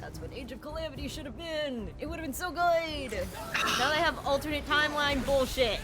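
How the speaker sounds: high, worked-up fan rant; fan outrage. Real, not acted. Player venting about a game's timeline, worked-up outrage.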